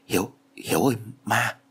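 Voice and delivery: narrated stammer with halting breaks; lightly voiced frightened stutter, breaking between words; stammering fear. A narrator's reading. Friend calls the narrator on seeing a ghost, stammering. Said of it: "lắp bắp bảo tôi".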